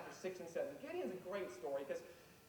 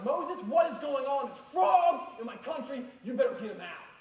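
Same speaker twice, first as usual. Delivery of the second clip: angry Pharaoh; 6 semitones higher, 11 dB louder than usual. Acted. Pharaoh angrily demands frogs removed.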